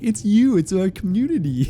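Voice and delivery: hammed-up heartfelt shout-out to the community; corny, cheesy. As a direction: ham up a cheesy thank-you to the fans. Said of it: "I could be super fucking corny and be like".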